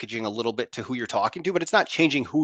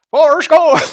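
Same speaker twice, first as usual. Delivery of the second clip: much higher, louder Southern drawl; Forrest Gump impression; 12 dB louder, slower than usual. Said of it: "changing the way they sound".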